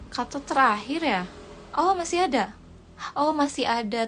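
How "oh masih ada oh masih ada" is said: surprised. Real, not acted. Surprised more words remain.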